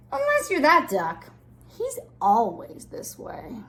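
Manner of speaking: put-on animated, lilting read-aloud for children; picture-book reading. Reading a rhyming moods book to little children, storytime voice. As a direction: read it with big pitch swings and stressed key words.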